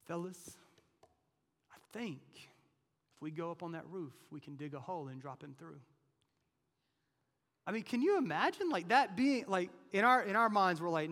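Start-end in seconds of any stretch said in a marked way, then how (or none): 0.1-5.8 hushed whisper to the fellas; sneaky whispered scheming; conspiratorial whisper. Acted. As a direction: lean in and whisper the plan. Friends plotting, voiced in story.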